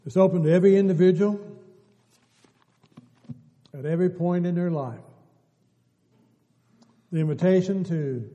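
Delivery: loud, high preacher call, rushing the open invitation; full-force preaching. Acted. Preacher proclaiming God's open invitation to every individual, loud full-force preaching. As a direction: ring it out to the whole church.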